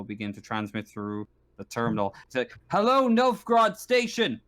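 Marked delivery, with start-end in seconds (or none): 2.7-4.4 high-pitched, urgent radio distress call; distress transmission. Acted. Stranded ship hailing Novgorod Station, urgent distress call. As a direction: call out for help over a poor radio link. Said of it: "this static coming through, uh, very sort of low quality audio".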